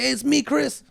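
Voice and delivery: high, friendly hey it's me; high chummy greeting. Pretend. Bluffing a fleeing victim, high chummy hello. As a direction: sell it like a longtime pal. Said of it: "Just say".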